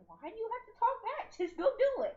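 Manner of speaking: put-on a parent scolding a back-talking kid; mock scolding dad. Voicing a scolding dad God.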